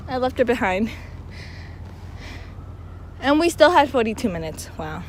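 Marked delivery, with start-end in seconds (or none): none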